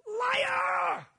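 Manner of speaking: loud, very high yell; shouted accusation. Acted. Pastor shouts the verse's accusation at false believers. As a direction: hurl the word at them. Echoing scripture's strong word.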